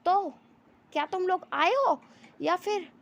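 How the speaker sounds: narrated shout. A narrator's reading. Wangji challenges the people in the cars, shouting. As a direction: call it out loud and high, challenging them. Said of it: "ज़ोर से चिल्ला के कहता है".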